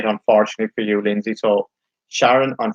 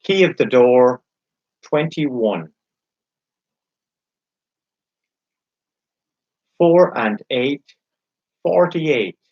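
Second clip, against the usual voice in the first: slow, drawn-out bingo calling; bingo caller; slower than usual. Acted. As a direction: call each number slowly, then repeat it.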